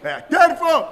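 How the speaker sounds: loud. Acted. Interrogator demanding confession.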